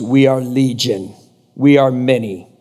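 low, slow mock demon voice; demonic Legion. Demons answer Jesus's question about their name, low ominous voice. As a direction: answer ominously, pitched well down.

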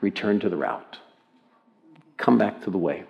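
soft, low murmur; hushed near-whisper. Acted. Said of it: "in a whisper".

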